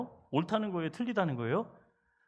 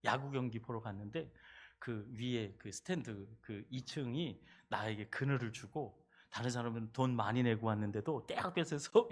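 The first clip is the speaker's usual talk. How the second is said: ordinary talk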